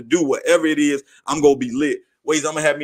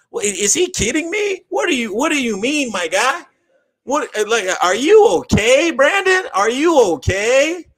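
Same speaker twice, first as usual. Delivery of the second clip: much higher, squeaky incredulous voice, slowing on are you okay; stunned disbelief; 8 semitones higher than usual. Real, not acted. Host reacting to Brandon's dink and dunk comment, high incredulous disbelief. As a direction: ask if he's okay in total disbelief.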